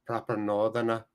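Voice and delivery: soft attempt at Northern English; Northern English accent. Streamer tests how British Bulldog talks, soft Northern accent. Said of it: "He talks like a proper Northern".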